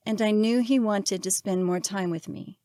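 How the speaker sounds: ordinary delivery, nothing marked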